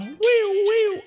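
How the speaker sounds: squealed high siren noise; high siren "wiu wiu". Pretend. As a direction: sound like a siren alarm. Imitating a siren in rap. (hohes Sirenengeheul)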